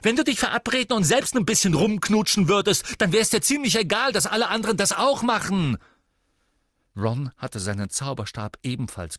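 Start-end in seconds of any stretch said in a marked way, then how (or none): 0.0-5.8 high, loud, relentless rant; screaming tirade. A narrator's reading. Sister derides her brother's crush, screaming in fury. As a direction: shout it all in one furious rush. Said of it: "schrie Ginny außer sich".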